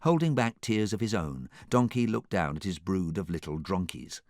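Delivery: ordinary delivery, nothing marked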